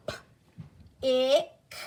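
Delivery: "P-, ick" split, a bare p then ick; sounding out. Shown as a demonstration. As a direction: isolate each sound with a gap. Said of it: "Sound it out with me".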